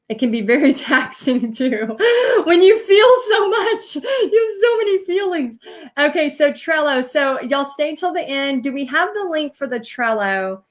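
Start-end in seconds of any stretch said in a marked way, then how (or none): 0.1-5.6 tearful laugh breaking into a higher pitch; half laughing, half crying, much higher; laughing through tears. Real, not acted. Host opening up to her livestream viewers, laughing through real tears. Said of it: "why am I so tearful today?".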